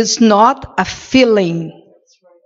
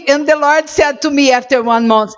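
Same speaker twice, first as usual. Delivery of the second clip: ordinary delivery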